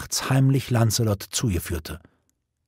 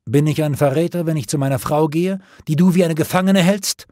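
raised, higher-pitched challenge; heated, defiant question; angry retort; 5 semitones higher, 4 dB louder than usual. A narrator's reading. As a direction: throw the accusation back indignantly. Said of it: "starrten sich zornig an".

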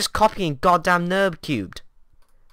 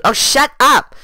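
annoyed shout; 8 semitones higher, 7 dB louder, slower than usual. Acted. Interrupting the other.

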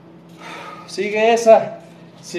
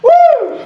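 high, loud whoop of energy; excited whoop; 5 dB louder than usual. Real, not acted. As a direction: let out a hype whoop. Coach whooping mid workout. (grito de euforia)

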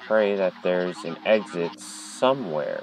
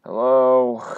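higher, louder call into the empty hall; 4 semitones higher, 6 dB louder than usual. Real, not acted. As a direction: call out to see who answers. Calling out in empty halls.

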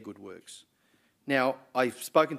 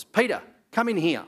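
high, loud call from the office; retold yell; 9 semitones higher, 7 dB louder than usual. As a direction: call him in loudly, imitating the boss. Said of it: "this voice also yelling very loudly".

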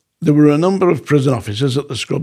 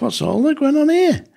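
alarmed question; 13 semitones higher than usual. Retold or imitated. Hearing shouting at night.

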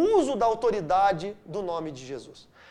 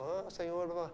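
mock bland prayer, much softer; oração sem graça; 6 semitones lower, 13 dB quieter than usual. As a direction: toss off a dull, lifeless prayer. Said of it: "sem graça, tipo". (oração mole e sem graça, bem baixinho)

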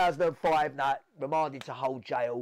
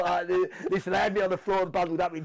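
breathless, higher and louder; out of breath; 4 semitones higher, 6 dB louder than usual. Real, not acted. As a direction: talk while still panting. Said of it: "but I was out of breath".

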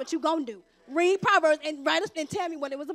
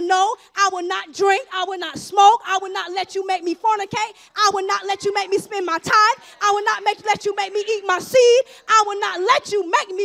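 defiant shout at the devil; 3 semitones higher, 7 dB louder than usual. Pretend. Preacher shouting defiance at the devil before the congregation. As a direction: stand up and shout him down. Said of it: "Tell the devil".

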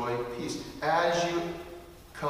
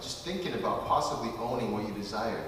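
ordinary delivery

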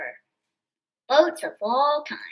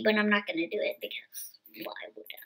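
quick unvoiced whisper, x1.4; a hushed, hurried aside; 5 semitones lower, 7 dB quieter, faster than usual. Real, not acted. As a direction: whisper it fast, just to the camera. Aside to viewer while reading.

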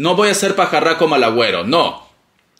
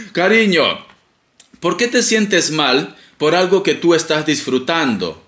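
ordinary talk

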